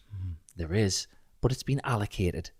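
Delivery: quiet, curt re-enacted answer; nasty reply. Husband replaying his answer about the cash, nasty reply. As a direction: give a soft but pointed refusal. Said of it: "answered a little bit nastier".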